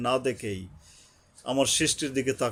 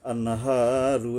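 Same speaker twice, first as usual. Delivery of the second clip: আন্নাহারু drawn out very slowly; slow recitation; 3 semitones lower, much slower than usual. Shown as a demonstration. Teacher shows the long vowel, very slow chant. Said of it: "একালিপ টানবেন".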